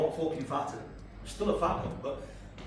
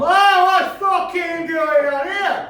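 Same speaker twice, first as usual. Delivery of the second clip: loud confrontational shout; 11 semitones higher, 11 dB louder than usual. Acted. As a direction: charge in yelling at the top of your voice. Acts himself charging into the street fight.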